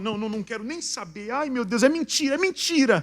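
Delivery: high, rising, frantic mock denial; mock panicked denial. Faithless person refusing to hear bad news, mock panicked denial. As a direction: build from protest to loud high wail. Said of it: "Já viu gente falar assim?".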